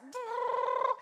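a sleepy, grumbling brrr, high and loud; grumpy grunt. Retold or imitated. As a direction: grunt like you've been up for hours and can't be bothered. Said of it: "and I'm just like".

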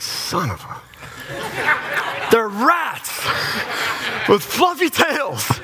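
slow, high-pitched exasperated wail; comic, stretched-out cry of indignation; mock outrage. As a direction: draw it out in hammed-up fury. Outraged punchline on squirrel culprit.

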